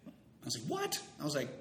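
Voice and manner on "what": high-pitched startled what; incredulous. Retold or imitated. Reacting to Farrell's news that he told Jim, incredulous. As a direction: leap up high in disbelief. Shocked reply to Farrell's admission.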